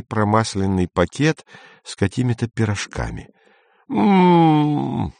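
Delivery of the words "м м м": long, high-pitched hum of relish; delighted "mmm" cry. A narrator's reading. Izya receives a bag of pies, humming with relish. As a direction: exclaim through a drawn-out hungry hum. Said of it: "вскричал Изя".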